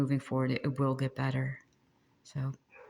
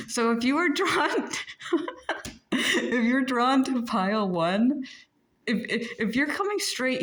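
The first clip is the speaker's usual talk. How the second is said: high-pitched words breaking into laughter; laughing; 8 semitones higher than usual. Real, not acted. Explaining pile one to listeners, laughing too much to finish. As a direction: try to speak through real giggles. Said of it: "you're gonna know why I'm laughing".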